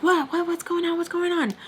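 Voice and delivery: high-pitched retold alarm; rapid-fire startled questions, pitched right up. Woman rushing out in a towel, alarmed retold questions.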